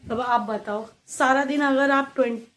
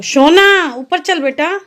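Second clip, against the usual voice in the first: higher, louder, slow call to the little one; 5 semitones higher, 7 dB louder, slower than usual. Real, not acted. Mother coaxing her toddler to come upstairs, slow loud call. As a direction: draw the words out to coax her upstairs.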